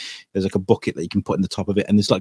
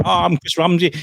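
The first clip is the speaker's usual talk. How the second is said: a hint of north-east English accent, higher; mock north-eastern accent, pitched up; Chris Ramsey; 7 semitones higher than usual. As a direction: lightly imitate a north-east England accent. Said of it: "you hear that accent".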